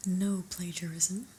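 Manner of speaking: ordinary talk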